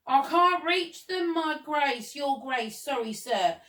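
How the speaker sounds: high apologetic call, settling as it goes; calling back. A narrator's reading. Gardener apologises to the Duke, calling back. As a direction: call down from the ladder, high then easing off. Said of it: "the gardener called back".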